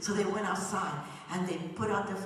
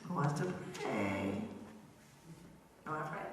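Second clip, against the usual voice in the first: lower, softer invitation to pray; gentle ask; 5 semitones lower, 8 dB quieter than usual. Real, not acted. Storyteller gently asks the children who will pray. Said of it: "So who wants to pray?".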